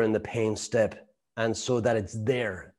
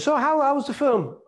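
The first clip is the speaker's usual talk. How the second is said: much higher, bright and chirpy; innocent cheery question; 13 semitones higher than usual. Retold or imitated. Husband to returning wife, much higher, breezy innocent question. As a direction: ask like you suspect nothing.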